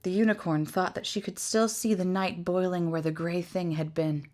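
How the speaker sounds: ordinary delivery, nothing marked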